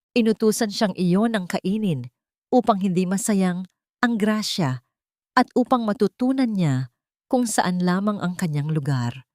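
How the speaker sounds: plain reading voice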